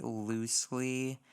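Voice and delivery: usual speaking voice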